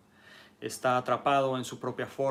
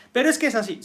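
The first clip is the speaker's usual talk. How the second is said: much higher and louder, digging in; stubborn insistence; 11 semitones higher, 11 dB louder, much faster than usual. Acted. Rigid person pushing back on the student, loud insistence. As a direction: insist like someone who won't move.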